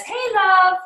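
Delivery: high, sing-song "Hey, love."; "Hey, love." said high and sugary, poking fun; mock gushy greeting. Shown as a demonstration. To her class, mocking an over-sweet greeting, high and sing-song. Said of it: "I am not the person that says".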